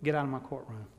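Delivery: a big judge letting him off, quietly; gruff judge. Retold or imitated. Preacher as the judge pardons a scared teenager, softly gruff. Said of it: "He said".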